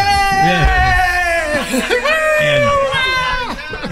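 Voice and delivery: high shouted cheer like a roaring crowd. Retold or imitated. Radio host retelling the crowd's reaction to Billy, imitating a roaring cheer. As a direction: raise the roof like the whole gym cheering. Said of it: "they bring the house down".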